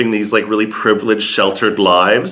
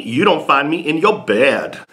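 ordinary delivery

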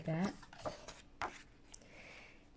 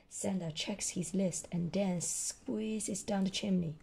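ordinary delivery, nothing marked

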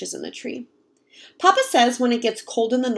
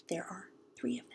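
a soft whispered hint; 3 semitones lower, 19 dB quieter, faster than usual. Shown as a demonstration. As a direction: whisper the answer like a secret. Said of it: "whispered hint to listeners".

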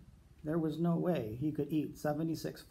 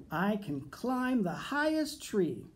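higher, proud bear boast; 6 semitones higher than usual. A narrator's reading. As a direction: brag it upward like a cocky bear. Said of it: "Bear boasted".

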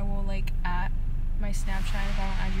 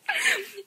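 short high giggle; high burst of laughter; 13 semitones higher than usual. Real, not acted. Vlogger cracking up while retelling the mix-up, high laugh.

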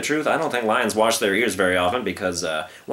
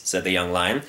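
usual speaking voice

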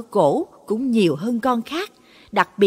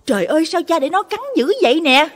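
high, loud, fast panicked yell; panicked shout; 8 semitones higher, 5 dB louder, faster than usual. A narrator's reading. Son sees dog biting his father, panicked yell. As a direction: cry out in alarm. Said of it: "nó vừa la lớn hốt hoảng".

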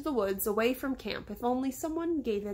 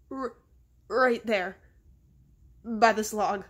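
halting, stuttered, mildly fearful; frightened stammer; 5 dB louder, slower than usual. A narrator's reading. Terrified boy points out the snake to his friend, stammering. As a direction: stumble on the first sound, then short halting phrases.

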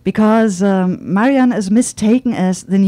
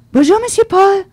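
high, loud cheerful greeting; young waitress calling a hello, high and loud; called out; 12 semitones higher, 5 dB louder than usual. Acted. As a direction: call across to him brightly. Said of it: "called Laurine".

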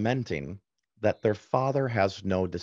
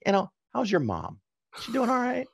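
higher, breezy change of subject; evasive dodge; 14 semitones higher than usual. Retold or imitated. Father dodging his wife's question with higher, breezy fake cheer. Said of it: "And I go".

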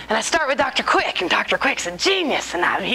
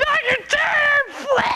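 high, drawn-out wailing shout; mock meltdown; much slower than usual. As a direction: cry out the teacher's name in hammed-up despair. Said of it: "I was having a bad day".

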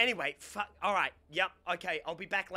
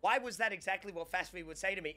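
ordinary talk

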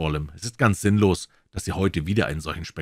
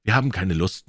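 ordinary delivery

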